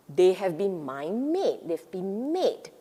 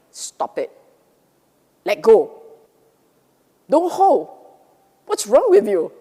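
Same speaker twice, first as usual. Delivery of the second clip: loud, sharp commands; stern scolding; 10 dB louder than usual. Pretend. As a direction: scold your own mind. Scolding your own angry mind.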